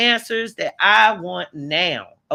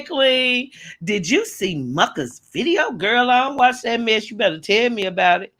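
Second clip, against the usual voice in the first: ordinary talk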